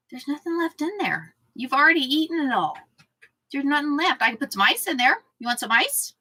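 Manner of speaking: lilting talk to an animal; pet talk. Real, not acted. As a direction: reason sweetly with a begging dog. Talking to the whining pet.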